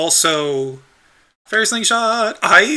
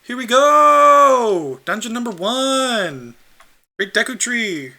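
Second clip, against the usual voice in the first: high, slow, hyped-up announcement; excited announcing; 8 semitones higher, slower than usual. Real, not acted. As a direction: call it out like a grand opening. Announcing the first dungeon.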